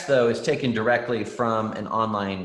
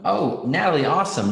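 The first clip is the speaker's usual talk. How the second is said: louder, bright burst of praise; light up at Natalie's good work; teacher happily surprised by a student's answer; pleased surprise; 5 semitones higher, 7 dB louder than usual. Real, not acted.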